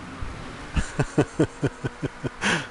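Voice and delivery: breathy laugh. Real, not acted. Laughs at chat joke.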